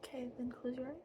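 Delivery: breathy unvoiced whisper; fairy whisper. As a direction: whisper as eyes close.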